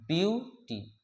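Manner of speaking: "Beauty" as rising view, pause, टी; split into syllables. Shown as a demonstration. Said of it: "ये दो syllable वाले words हैं".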